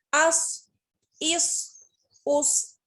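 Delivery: अस with a thick, heavy स. Shown as a demonstration. Teacher to students, heavy s sound. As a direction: make the स in अस full and heavy. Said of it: "स्वाद की आवाज को मोटा करेंगे".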